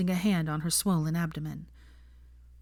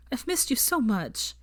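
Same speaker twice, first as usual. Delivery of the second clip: higher, slow and tearful; 6 semitones higher, slower than usual. A narrator's reading. Hermione in Ron's arms, tearfully relieved. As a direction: let it break with relief.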